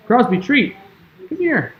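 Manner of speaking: sweet high dog-calling voice; calling a pet. Real, not acted. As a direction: coax the dog over in a soft, squeaky voice. Calling the dog over with a treat.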